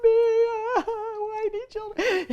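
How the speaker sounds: high, wailing, crying imitation; mock sobbing. Mother reenacts crying at the TV, high wailing sob. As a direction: wail it like a heartbroken mom. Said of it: "I'm like".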